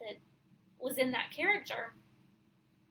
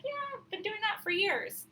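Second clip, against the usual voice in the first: higher-pitched, retold know-it-all brush-off; mock dismissive; 9 semitones higher than usual. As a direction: imitate yourself brushing off old news. Acting out past dismissive reaction.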